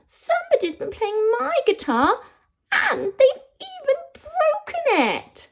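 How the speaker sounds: put-on squeaky baby troll about to cry. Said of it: "Baby Troll says".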